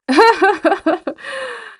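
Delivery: high, loud, nervous burst of laughter; awkward high laugh. Real, not acted. Streamer laughing nervously at the awkward mistake, high and loud. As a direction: laugh off the embarrassment.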